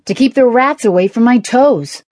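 high, loud huffy retort; exasperated, wounded-pride answer; indignant. A narrator's reading. Mocked man defends himself.

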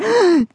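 high startled gasp; sudden gasp of alarm; sharp gasp. Acted. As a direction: gasp in shock. Trampled by oxen.

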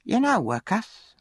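high, drawn-out needling; taunting charity boy. A narrator's reading. Charity boy goads the orphan about his mother, high slow taunting.

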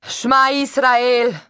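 high, loud and very slow call; a stranger's high, drawn-out loud call to the people. Acted. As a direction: cry it out high over the crowd. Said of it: "eine hohe Stimme".

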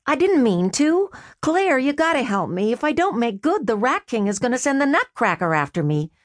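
lightly voiced brother making excuses; Freddy's voice. Brother excusing his lost bet to his sister, lightly voiced sheepish.

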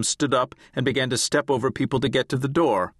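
usual speaking voice

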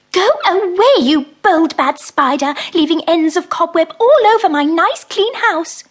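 high, loud scolding little mouse; cross mouse. Acted. Mrs Tittlemouse scolds the spider out of her house, high indignant mouse voice. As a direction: shoo him out, fussy and indignant.

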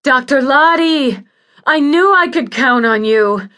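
loud, high-pitched desperate pleading; patient wailing in pain for relief; pained, demanding cry. Acted. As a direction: beg loudly and high, urgent with pain.

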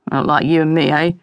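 lightly voiced low, teasing quip; playful quip. A narrator's reading. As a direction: toss it off as a low-pitched quip. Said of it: "Ralph quipped".

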